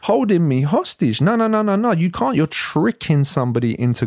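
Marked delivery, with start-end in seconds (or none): 1.2-2.3 emphatic refusal. Real, not acted. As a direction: shut it down hard.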